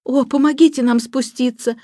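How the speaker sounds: put-on high-pitched begging girl; pleading. A narrator's reading. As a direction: beg him for help. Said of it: "молила его Джейн". (умоляюще)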